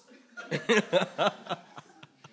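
loud burst of laughter; laughing. Real, not acted. Preacher cracking up at his own shouted jeer, laughing. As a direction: laugh out loud after the performance.

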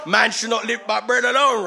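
very loud, high, laughing shout; triumphant retort. Acted. Preacher throwing Matthew four at the enemy, triumphant shout. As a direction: fire the verse off with a laugh.

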